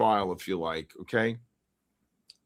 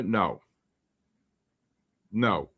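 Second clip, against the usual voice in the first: usual speaking voice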